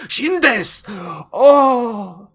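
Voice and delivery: high, loud anime shout; anime exclamation. Pretend. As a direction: blurt it like an anime character. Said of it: "Everything that I know in Japanese is exclamatory".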